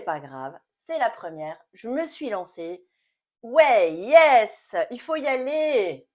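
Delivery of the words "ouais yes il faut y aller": loud, excited rallying cheer; self-cheering. Real, not acted. Vlogger spurring herself to launch her first video, excited. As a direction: cheer yourself on, loud and higher.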